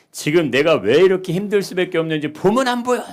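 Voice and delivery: loud, high plea of a struggling person; voiced cry of despair; desperate outcry. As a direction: cry out for understanding. Voicing desperate people crying out.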